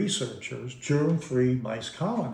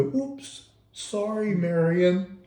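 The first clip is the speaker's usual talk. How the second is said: higher, sheepish little apology; 7 semitones higher, much slower than usual. Retold or imitated. Drunk dad apologizing to his wife, high and sheepish. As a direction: play the caught drunk husband, meek and apologetic. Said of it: "turn around sheepishly and go".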